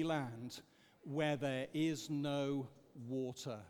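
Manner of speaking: slow, solemn reading aloud together; group scripture reading. Real, not acted. Leading the church in reading a psalm aloud, slow and solemn. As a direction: read the psalm slowly and reverently. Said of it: "Let's read this together".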